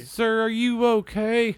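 put-on high, slow little boy voice; kid's voice. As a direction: ask like a worried twelve-year-old boy. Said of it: "the twelve-year-old boy next to me who asked me".

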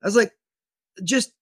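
high, baffled disbelief; caught off guard. Retold or imitated. Homeowner asking the officer aiming at him, high baffled shock. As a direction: pitch shoots up in shocked confusion. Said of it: "I was so caught off guard".

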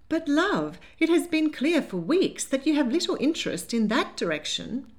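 put-on a flustered older lady clutching her pearls; fluttery Lady Hartwood. A narrator's reading. Lady Hartwood scolding her granddaughter, high and fluttery.

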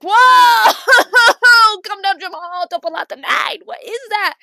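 high, loud panicked yelling; hammed-up freak-out at a spider; imitating a startled mom shrieking; mock panicked shriek. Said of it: "she freaked out".

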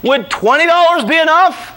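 very high, projected comic delivery; high, exaggerated declaiming; grand bribe offer. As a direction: call it out boldly to the sergeant. Acting his bribe offer.

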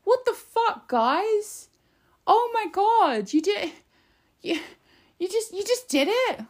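very high-pitched, exaggerated outraged disbelief; mock complaining. Commentator imitating government complaining that workers went on strike, hammed-up disbelief. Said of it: "they'll complain and they'll be like".

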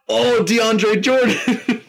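high, loud, drawn-out exclamation; excited surprise. Real, not acted. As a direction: burst out at an unexpected find. Spots unexpected player in draft.

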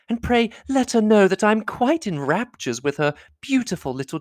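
high-pitched, fussing society lady; effusive lady admirer, pitched high; gushing flatterer. A narrator's reading. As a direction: fawn over him in a high, swooping voice. Voices Miss Bingley in raptures.

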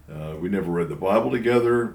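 normal talking voice

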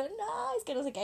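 mock whine, high and soft; retold tearful whine. Drunk girl protesting to her friend, mock tearful whine. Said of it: "está llorando esta vieja".

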